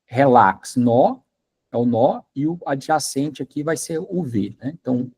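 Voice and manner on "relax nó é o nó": ordinary delivery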